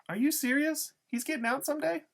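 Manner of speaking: very high, loud disbelieving questions; incredulous. A narrator's reading. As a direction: shoot the pitch way up in disbelief. Loretta is incredulous at Iggy's news.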